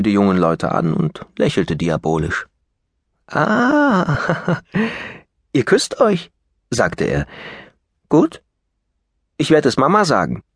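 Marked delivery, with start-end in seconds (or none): 3.3-6.3 very high, slow teasing; mocking boy. Brat teasing the lovers. 8.1-10.4 high, sing-song threat; taunting boy. Acted. Brat threatening to tell.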